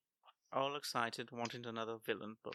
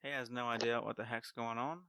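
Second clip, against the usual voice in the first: ordinary talk, nothing marked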